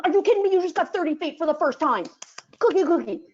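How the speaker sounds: high, loud exclaiming from the sideline; loud high mock outrage then cookie praise; excited disbelief. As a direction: cheer the dog on, incredulous. Instructor voicing herself on sideline.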